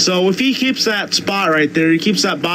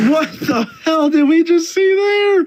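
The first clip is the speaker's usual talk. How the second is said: stunned; 12 semitones higher than usual. Real, not acted. Stunned after photo finish.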